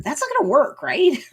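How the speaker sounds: high-pitched, doubtful mock query; skeptical aside. Jen breaking the fourth wall, doubtful. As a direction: imitate an incredulous check with the viewer. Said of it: "Jen does a fourth wall break".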